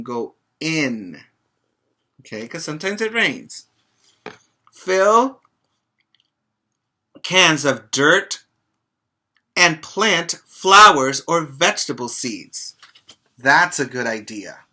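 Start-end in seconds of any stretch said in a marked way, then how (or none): 4.7-12.8 put-on lively read-aloud for little kids, a bit slow in places; reading to children. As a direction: read it out warmly to a group of children. Reads picture book to children.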